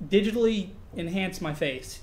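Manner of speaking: ordinary talk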